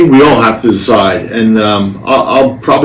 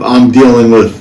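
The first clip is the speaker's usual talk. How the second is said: ordinary talk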